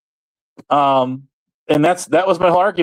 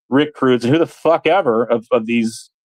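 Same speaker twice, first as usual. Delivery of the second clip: normal talking voice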